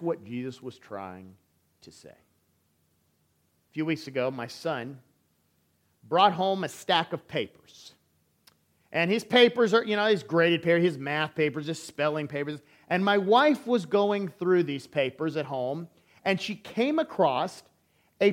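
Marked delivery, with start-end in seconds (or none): none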